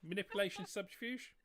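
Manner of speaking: teasing lilt to sell the trickery; mock sinister. To the players, teasing them about deception, a high playful lilt.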